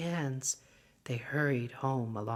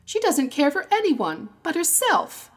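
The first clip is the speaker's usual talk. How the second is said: put-on much higher, louder girlish outrage; indignant schoolgirl; 11 semitones higher, 9 dB louder than usual. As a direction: spit it out like a fed-up pupil. Schoolgirl complaining about teacher.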